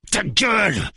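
high-pitched angry cry; bearded gunman's sudden cry; shouting. A narrator's reading. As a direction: shout it high, cutting him off. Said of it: "crie le barbu".

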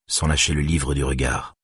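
ordinary delivery

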